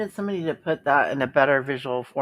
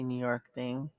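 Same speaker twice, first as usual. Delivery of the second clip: ordinary talk, nothing marked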